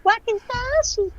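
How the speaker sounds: high, slow sing-song Wakizashi!; cute voice. Shown as a demonstration. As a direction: squeal Wakizashi! slowly and cutely. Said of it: "say it cute".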